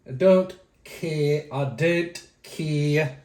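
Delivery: very slow, drawn-out put-on delivery; exaggerated slow repeat. Vlogger brushing off viewers' opinions, exaggeratedly slow. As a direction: drag every word out playfully.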